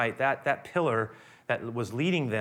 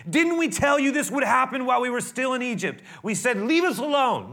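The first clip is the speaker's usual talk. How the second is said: angry complaint; 9 semitones higher, 6 dB louder than usual. Retold or imitated. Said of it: "And they said to Moses".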